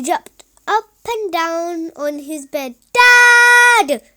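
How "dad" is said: high, loud yell; yelling for dad. Acted. Little boy yelling for his dad from bed. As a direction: call out to Dad in a panic. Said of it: "he yelled".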